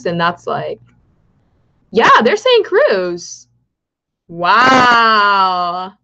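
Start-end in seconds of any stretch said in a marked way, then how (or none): 1.9-3.5 slow, high and loud, savoring each word; excited realization. Real, not acted. Streamer reading favorable poll numbers to chat, drawn-out excited realization. Streamer reads election results live. 4.3-5.9 a loud, stretched-out gasp of wonder; amazed wow. Real, not acted. Streamer seeing her candidate lead, loud amazed wow. Reacting to election results live.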